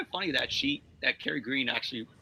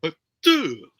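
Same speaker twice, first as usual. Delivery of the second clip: voice bit; 3 semitones higher, 7 dB louder than usual. Shown as a demonstration. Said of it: "why don't you do that".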